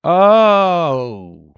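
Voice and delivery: a loud, high, thrilled oh; excited loud oh. Real, not acted. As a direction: gasp of delight at the record. Reacting to vinyl find.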